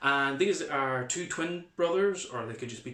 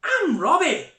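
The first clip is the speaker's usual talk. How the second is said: put-on high excited boy voice, much higher and louder; child character voice; 14 semitones higher, 11 dB louder, slower than usual. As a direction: play a lively young Ulster boy. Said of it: "let's read through it".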